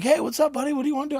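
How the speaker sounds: cheerful greeter. Retold or imitated. Prosthetist welcoming the new patient, hammed-up cheery greeting. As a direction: greet him like an eager salesman.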